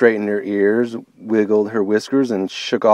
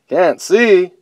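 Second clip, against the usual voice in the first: much higher, louder exclaim; astonished bear; 8 semitones higher, 6 dB louder than usual. Acted. Bobo learning bunny cannot see, astonished. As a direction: exclaim it in disbelief. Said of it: "exclaimed Bobo".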